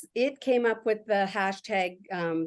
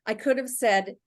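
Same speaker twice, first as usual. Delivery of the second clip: normal talking voice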